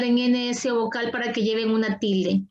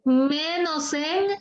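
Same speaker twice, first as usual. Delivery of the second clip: "Menos en" slow and higher, left hanging; leading prompt; 4 semitones higher, much slower than usual. Real, not acted. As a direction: stretch "Menos en" upward for the student to finish. Teacher leaves blank for student to fill. ("Menos en" lento y agudo, dejado en suspenso)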